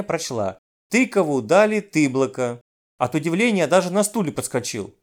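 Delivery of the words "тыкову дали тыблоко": plain reading voice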